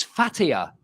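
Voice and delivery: "Fatia" as FAT-ee-uh, with an F; misread spelling. Shown as a demonstration. Explaining the old road sign spelling, comic misreading. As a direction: start "Fatia" with F, stress on FAT. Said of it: "spell Pattaya in English with a H".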